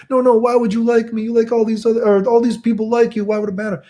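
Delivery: high, fretful girl imitation; insecure girl. Nervous girl refusing reassurance from streamer, high imitated fretting. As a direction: protest in a high, mock-girlish voice.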